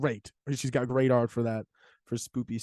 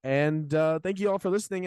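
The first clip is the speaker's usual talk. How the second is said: normal talking voice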